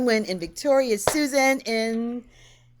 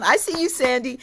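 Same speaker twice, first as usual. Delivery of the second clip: normal talking voice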